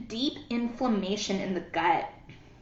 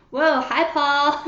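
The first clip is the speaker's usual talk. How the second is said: excited greeting; 5 semitones higher, 6 dB louder, slower than usual. Real, not acted. Greeting viewer mid-session.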